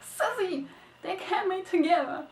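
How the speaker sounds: rejoice that the couple can stay together; joyful cheer. Retold or imitated. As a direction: reader celebrating in delight. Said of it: "I was like".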